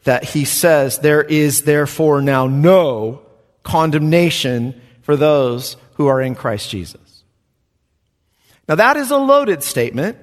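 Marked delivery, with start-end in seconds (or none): 1.0-7.2 NO drawn out slowly with weight; slow, weighty declaration of the verse; slow emphatic reading. Shown as a demonstration. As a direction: lean hard on "no" and let the verse build. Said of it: "crescendos here to this glorious verse".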